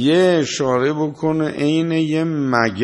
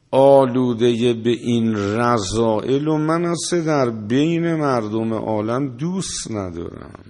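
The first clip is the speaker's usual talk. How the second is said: ordinary talk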